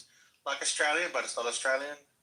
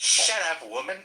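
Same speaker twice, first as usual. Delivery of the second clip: mock angry retort; 7 semitones higher, 6 dB louder than usual. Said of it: "He goes".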